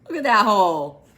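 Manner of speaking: plain reading voice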